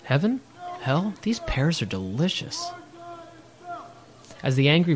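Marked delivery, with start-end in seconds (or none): 0.0-2.7 breezy indifference. Pretend. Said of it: "It's as if all of Portland is saying".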